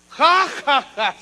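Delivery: high, scornful ha-ha; mocking laugh. Pharaoh laughing scornfully at Moses. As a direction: laugh in his face. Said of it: "se ríe el faraón".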